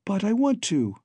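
put-on high, light girl's voice calling up to the doctor; breezy and bright on the surface, fear underneath; lightly voiced Babs, light and laughing. A narrator's reading. Babs calls lightly up to Doctor Poulter, high and airy. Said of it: "call so lightly".

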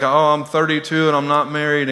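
normal talking voice

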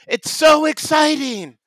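hammed-up thrill over nothing; mock excitement. [name] gushing to Matt about dancing, mock excited.

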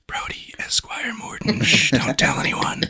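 imitating his secret whisper. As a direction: hush it like a secret. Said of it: "he was like".

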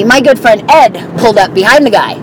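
usual speaking voice

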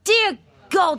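high shocked gasp. Acted. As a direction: recoil in alarm. Shocked by hidden man.

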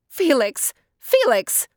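high, urgent call to a neighbor; summoning someone across a farmyard. A narrator's reading. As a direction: call the name out loudly, pitched high. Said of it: "she called out".

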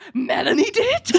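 high, laughing disbelief at Melanie; laughing high gasp of surprise; shocked question. Retold or imitated. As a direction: squeak out the question in mock shock. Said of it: "John's like".

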